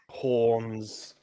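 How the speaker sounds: ordinary talk, nothing marked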